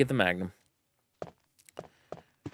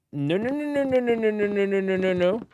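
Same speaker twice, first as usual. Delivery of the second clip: high, frantic repeated no; panicked; 10 semitones higher, 3 dB louder than usual. Real, not acted. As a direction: panic as it closes in. Monster appears, panicked pleading.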